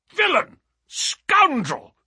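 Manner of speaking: high, loud outburst of indignation; outraged cry. A narrator's reading. Colonel cries out at the foul play Holmes describes, high outraged shout. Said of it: "cried the Colonel".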